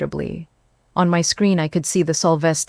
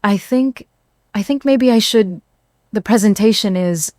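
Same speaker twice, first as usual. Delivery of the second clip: higher, halting, confused mumble; jumbled words; 4 semitones higher, 3 dB louder than usual. Acted. Husband on the call, impaired, jumbling his words. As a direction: drift off mid-phrase, lost. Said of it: "the words came out jumbled".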